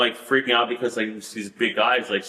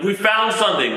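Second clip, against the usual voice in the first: mild, higher-pitched mock yell; mock scream; 7 semitones higher than usual. Searcher announcing a find to the team, retold mock scream. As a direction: call it out as an imitated shout.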